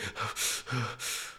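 short puffs of breath, imitating a calming toddler; huffed breaths; imitated child's breaths. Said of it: "And she went".

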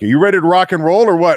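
loud, high and fast rally call; hyped-up coaches. Retold or imitated. GM and head coach rallying the new player, loud and fast.

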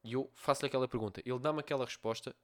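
ordinary talk, nothing marked

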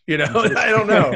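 high, loud burst with a laugh; amused. Real, not acted. Guest to hosts on who judges, amused and laughing. Amused at own rhetorical question.

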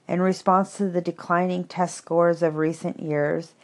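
usual speaking voice

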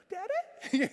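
put-on high, small child voice; timid little child. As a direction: peep out from hiding, unsure. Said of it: "this little face go".

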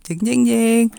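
loud mock suspense jingle; dramatic reveal sting. Storyteller setting up the surprise, loud mock sting. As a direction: voice a dun-dun-dun before the twist.

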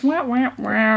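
slow whining wah; drawn-out mocking whine. Mocking sound of character.

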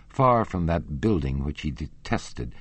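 usual speaking voice

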